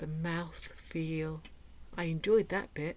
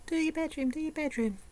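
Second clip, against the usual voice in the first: high, singsong nagging chant; nagging little voice; 9 semitones higher, faster than usual. Retold or imitated. Inner voice nagging about the bedroom, high singsong. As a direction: nag like a pestering inner voice. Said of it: "this little voice will be going".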